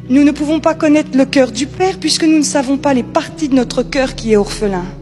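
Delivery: usual speaking voice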